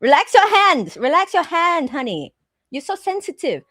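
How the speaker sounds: impatient tech scolding a customer; nail tech voice. Retold or imitated. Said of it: "It's just like".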